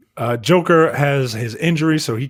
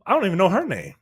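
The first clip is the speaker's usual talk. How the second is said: ordinary talk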